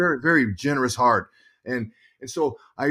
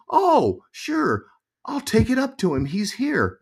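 much higher, bright sing-song lady voice; chirpy desk lady; 10 semitones higher, slower than usual. Retold or imitated. Quoting the hotel desk lady, high and chirpy. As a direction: play the sweet hotel clerk, pitched way up.

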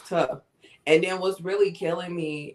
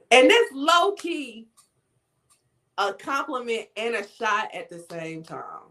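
ordinary talk, nothing marked

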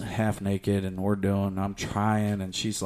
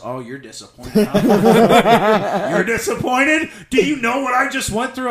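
higher, louder laughing outrage; mock indignation; 10 semitones higher, 8 dB louder than usual. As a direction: throw it back at him in disbelief. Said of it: "He goes".

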